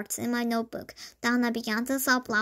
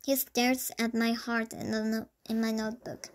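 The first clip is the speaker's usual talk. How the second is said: plain reading voice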